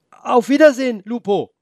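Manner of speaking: put-on boy calling after the leaving wolf; calling goodbye. Boy calls goodbye to the wolf, loud and high. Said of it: "rief er".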